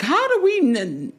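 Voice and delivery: high, slow mock disbelief; incredulous high voice. Host mimicking puzzled listeners, high and incredulous. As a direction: stretch it out, high and baffled. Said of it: "people are like".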